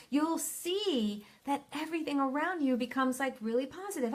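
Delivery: usual speaking voice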